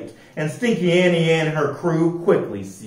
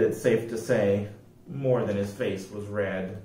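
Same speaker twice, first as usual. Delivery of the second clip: usual speaking voice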